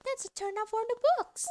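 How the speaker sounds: high, chatty character voice; surprised game character. Acted. Character gossiping about Mister Reaper's friend, high and surprised. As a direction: read it high with lively surprise.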